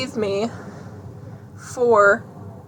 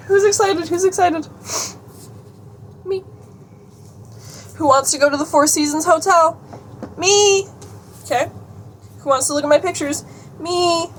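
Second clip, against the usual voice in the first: playful hype; 6 semitones higher than usual. Pretend. Mock call and answer with herself.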